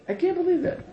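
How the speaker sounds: incredulous. Shown as a demonstration.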